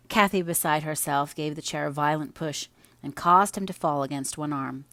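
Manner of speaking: plain reading voice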